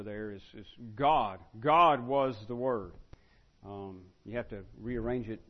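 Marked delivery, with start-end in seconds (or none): 0.9-2.9 GOD hit loud, then "was the Word" drawn out slowly; emphasis on God. Shown as a demonstration. Teacher showing the class John's Greek word order, emphatic on God. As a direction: punch GOD first, stretched and weighty. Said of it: "John's emphatic about it, the, the word order".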